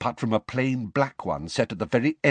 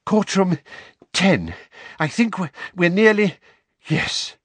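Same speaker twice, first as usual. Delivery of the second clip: out of breath; 9 semitones higher, 6 dB louder than usual. A narrator's reading. Weasley running, out of breath.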